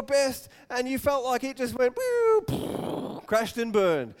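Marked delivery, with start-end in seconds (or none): 2.0-3.2 high whistling fall; whooshing crash sound. Retold or imitated. Preacher miming a failed sermon crashing, high whoosh. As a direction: mimic something plummeting.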